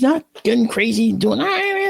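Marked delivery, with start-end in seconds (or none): 1.4-1.9 high-pitched imitation of a panicking fox; wild, high mock wail; mock frantic animal screech. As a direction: screech like a trapped animal gone crazy. Imitates a trapped fox going crazy.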